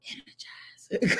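slow, hushed product-name tease; playful whisper. Pretend. Showing off a lipstick to viewers, playful slow whisper. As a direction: whisper it slowly, stretched out.

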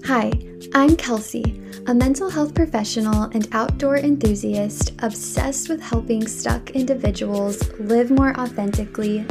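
upbeat announcer read over music; podcast intro read. Acted. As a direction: sell the show like a polished intro.

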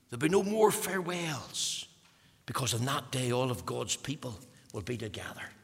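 usual speaking voice

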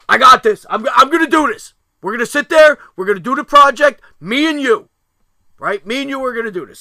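loud, high, emphatic declarations; forceful retold shouting; shouted take-charge. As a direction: re-enact a dad taking over at full volume.

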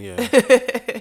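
high, loud, drawn-out laugh. Real, not acted. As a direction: laugh through the words. Laughs at cousin remark.

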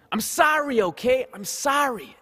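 put-on a whiny Bronx kid shouting sorry; Bronx accent. Kid caught letting go, Bronx accent shout. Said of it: "the kid spoke with a Bronx accent".

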